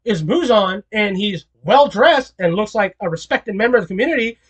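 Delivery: ordinary talk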